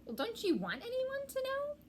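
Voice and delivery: high puzzled mouse voice; ask, puzzled and tiny; small mouse voice. A narrator's reading. Mouse questioning Ratty, high puzzled voice.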